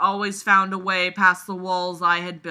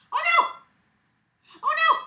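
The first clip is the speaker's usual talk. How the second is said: tiny, very high "oh no" squeal; squealed mock alarm; 6 dB louder, much faster than usual. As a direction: squeal it in playful panic. Playful cries while pet bites.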